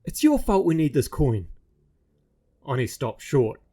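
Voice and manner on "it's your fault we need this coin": put-on exasperated boy. Roo blaming the doll.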